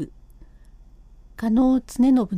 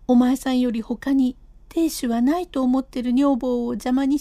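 wife's alarm. Acted. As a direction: beg him, scared and indignant.

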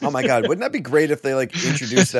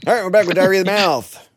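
announcer; 10 semitones higher, 10 dB louder than usual. Acted. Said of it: "show return announcement".

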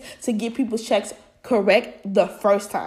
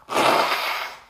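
tired audible sigh; weary sigh. Real, not acted. After blowing her nose.

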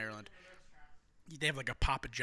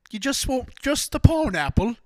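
high, loud, incredulous waiter imitation; mock waiter; 10 semitones higher, 10 dB louder than usual. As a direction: play the baffled waiter, pitched up and loud. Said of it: "the waiter was like".

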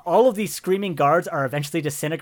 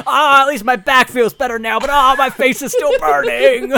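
high, loud mock relief turning to wailing; comic pained whine; 8 semitones higher, 8 dB louder than usual. The billionaire whines to the party about his burning face, high and loud. Bezos after pills.

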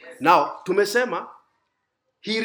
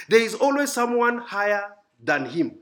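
ordinary talk

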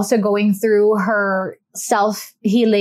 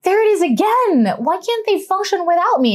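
annoyed; 10 semitones higher than usual. Retold or imitated. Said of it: "she's just like".

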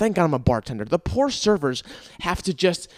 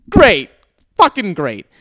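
loud, high sarcastic outburst; sarcastic anger; 5 semitones higher, 8 dB louder, slower than usual. Retold or imitated. To the guy warning about the tow, loud sarcastic outburst. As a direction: spit it out bitterly.

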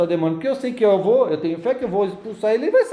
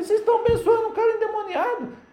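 indignant; 11 semitones higher than usual. Retold or imitated.

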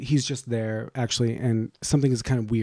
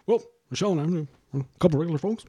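higher, put-on folksy politician voice; Obama impersonation; 6 semitones higher, faster than usual. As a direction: play the humble president. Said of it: "He was behind me".

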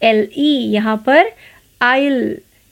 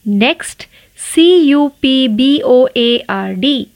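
normal talking voice

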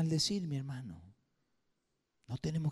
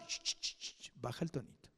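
hushed, much softer, half-whispered shush; 2 semitones lower, 13 dB quieter than usual. Retold or imitated. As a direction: hiss him quiet like a parent in church. Shushing the son to lower voice.

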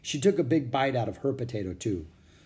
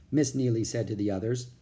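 plain reading voice

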